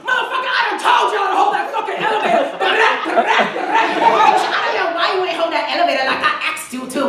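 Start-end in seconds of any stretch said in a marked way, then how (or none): 0.1-5.5 fast, higher shouted rant; sassy rant. Acted. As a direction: rattle off the angry complaint. Acted elevator rant.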